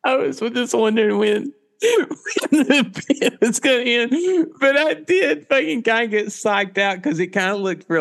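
high voice through laughter; laughing. Real, not acted. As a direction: talk while cracking up. Laughing reply.

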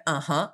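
"Uh-huh" rising at the end; "Uh-huh" as a questioning yes. Shown as a demonstration. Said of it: "That sounds like a question".

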